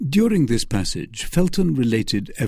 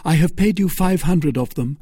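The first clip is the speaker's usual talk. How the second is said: Felton; 7 semitones higher, 4 dB louder than usual. Acted. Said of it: "said Felton".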